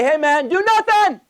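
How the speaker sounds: high, drawn-out slogan; mock cheery farewell. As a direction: sing out a silly goodbye, slow and high. Mock farewell repeated, exaggerated.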